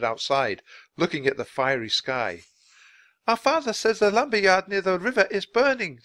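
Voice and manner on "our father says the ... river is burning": put-on high, urgent boy's shout; raised, high-pitched child's call. A narrator's reading. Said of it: "the boy shouted".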